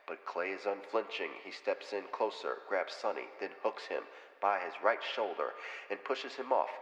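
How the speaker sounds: quiet, rhythmic blow-by-blow; soft fight narration. Acted. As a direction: narrate the punches softly. Hushed fight play-by-play narration.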